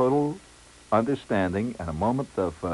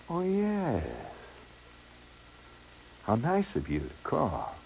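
soft, sultry, drawn-out imitated woman; melting phone voice; 6 semitones higher, 7 dB quieter, slower than usual. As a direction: gush softly, letting each phrase melt. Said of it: "the kind of voice that just comes melting".

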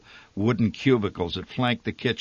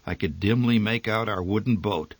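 ordinary delivery, nothing marked

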